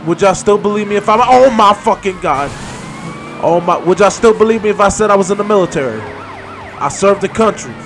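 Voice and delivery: rising panic, loud and quick; panicked pleading. Real, not acted. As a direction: talk your way out, frantic.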